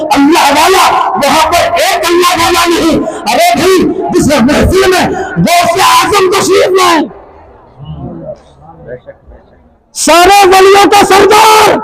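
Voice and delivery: high, strained, impassioned sermon cry; full-force preaching. Acted. Preacher urging devotees to listen to Allah's word, full-force preaching.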